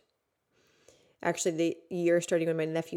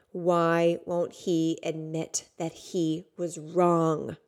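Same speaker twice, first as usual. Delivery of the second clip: slow repeated plea; much slower than usual. Retold or imitated. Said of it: "over and over and over".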